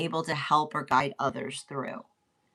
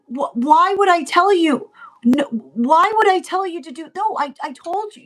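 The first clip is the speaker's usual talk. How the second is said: much higher and louder, exasperated parent; exasperated outburst; 10 semitones higher, 8 dB louder than usual. Acted. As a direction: throw up your hands at the child. Acted exasperated parent arguing.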